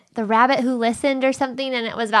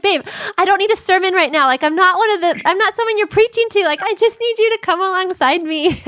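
higher, louder, half-laughing pleading with husband; exasperated plea to husband; 8 semitones higher, 4 dB louder than usual. Retold or imitated. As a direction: pitch it up and plead to be heard, not preached at. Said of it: "I'm like".

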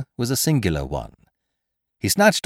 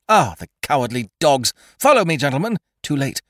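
1 urgent dismay; 6 semitones higher than usual. A narrator's reading. Stranger despairs, rushing.

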